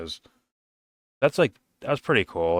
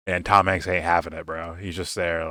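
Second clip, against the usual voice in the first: ordinary delivery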